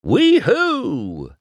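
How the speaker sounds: high, loud whoop of joy; excited shout. A narrator's reading. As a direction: whoop it out to the morning. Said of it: "Mater shouted".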